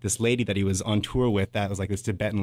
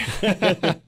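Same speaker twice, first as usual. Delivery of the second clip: quick rising chuckle; short laugh; 10 semitones higher than usual. Real, not acted. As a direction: laugh at your own joke. Laughing at his own remark.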